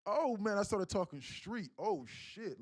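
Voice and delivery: loud, high-pitched self-aware outburst; alarmed at your own slip; startled realization. Real, not acted. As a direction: catch yourself slipping, out loud. Catches himself talking street on stream.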